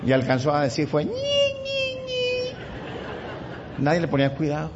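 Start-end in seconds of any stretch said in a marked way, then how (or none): 0.9-3.8 high, thin squeal of a tiny car horn; hammed-up squeaky horn noise; squeaky horn imitation. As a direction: mimic a pathetic little horn, very high.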